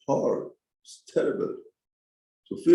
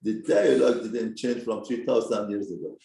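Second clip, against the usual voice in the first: ordinary talk, nothing marked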